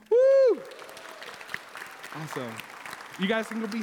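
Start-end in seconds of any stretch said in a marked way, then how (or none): 0.1-0.6 loud high woo; short high cheer; cheering whoop. Real, not acted. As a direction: whoop to end the story. Cheers end of story.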